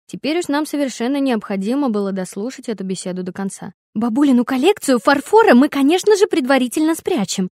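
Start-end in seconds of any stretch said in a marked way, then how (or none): 3.9-7.5 loud, high, breathless yelling; choking with shouting. A narrator's reading. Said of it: "захлёбывалась от крика Флоренс". (захлёбываясь от крика)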